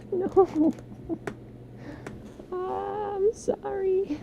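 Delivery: high giggly sorry, slowed; laughing apology. Real, not acted.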